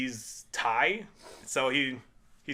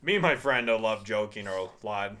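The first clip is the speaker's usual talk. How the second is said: ordinary talk, nothing marked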